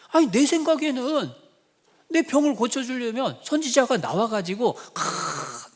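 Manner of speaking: a huffy general airing his grievance; Naaman's indignation. Retold or imitated. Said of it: "화가 난 이유가".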